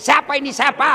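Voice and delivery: loud, high "Siapa ini? Siapa?" with a laugh; mock-furious wife interrogating her husband; jealous wife demanding. Wife grows louder asking.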